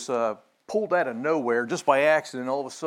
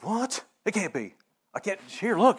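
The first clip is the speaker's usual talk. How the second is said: a hint of a shocked master, jumping high on What; mock disbelief; 6 semitones higher than usual. Kung Fu master grabbing the scroll, lightly voiced disbelief. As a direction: sell the master's stunned denial. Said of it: "the master guy there's like".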